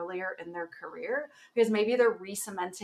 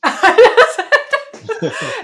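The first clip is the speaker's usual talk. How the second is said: loud high laughter; 19 dB louder than usual. Real, not acted. Recalling meeting her idol, loud laughter.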